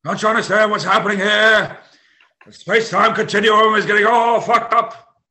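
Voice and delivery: high, loud, frantic scientist; frantic Doc Brown. Pretend. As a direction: yell it like a mad scientist. Doc Brown from Back to the Future.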